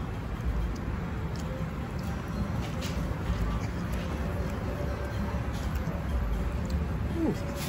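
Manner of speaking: breathy laugh. Real, not acted. Laughing at the heat.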